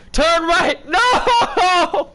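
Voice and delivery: rising high, loud yell; turn-right shout growing; building to a shout. Real, not acted. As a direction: escalate the command to a scream.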